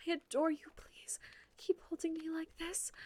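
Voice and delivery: ordinary talk, nothing marked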